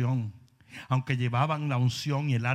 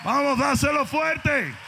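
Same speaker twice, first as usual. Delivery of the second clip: loud, high, slower rallying shout; shouting again; 12 semitones higher, 7 dB louder, slower than usual. Acted. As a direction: push them harder, stretching each word. Repeated urging, shouting.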